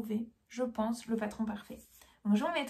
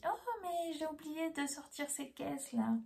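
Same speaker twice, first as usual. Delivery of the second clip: higher, softer quoted voice; imitating the stallholder; 6 semitones higher, 6 dB quieter than usual. Stallholder remembers forgotten crates, imitated high and soft. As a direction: mimic her higher-pitched, softly voiced "oh".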